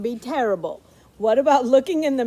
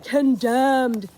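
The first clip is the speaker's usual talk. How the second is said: booming warning sign; 7 semitones higher, slower than usual. Pretend. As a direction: bark each word like an alarm sign. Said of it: "and it's saying".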